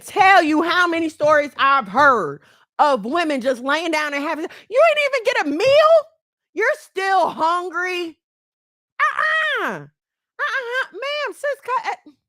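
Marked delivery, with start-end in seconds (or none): 4.7-10.9 high, loud incredulous "Uh-uh"; outraged disbelief. Real, not acted. Reacting to women who didn't even get a meal, high and outraged. 10.9-12.1 high sharp "cut it" to sis; scolding. Real, not acted. Scolding a woman to stop it, high and firm.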